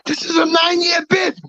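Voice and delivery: retold high, crying shout at the injustice; anguished shout. Ex-con telling the parole officer his sentence, crying shout. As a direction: voice cracking as he yells out the long sentence. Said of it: "You know what I told him?".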